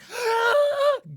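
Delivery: sharp high gasp of shock; loud imp gasp. Acted. Elemental imps at the table gasping high and loud. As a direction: gasp like a startled crowd of imps. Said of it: "gasp at the table".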